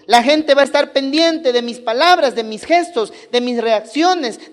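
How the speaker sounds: fervent preaching. Acted. Pastor exhorting the congregation about responsibility, fervent preaching. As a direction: build the sermon up with rising fervor. Preacher builds to full force.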